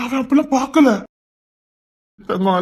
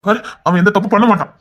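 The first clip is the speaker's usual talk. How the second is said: usual speaking voice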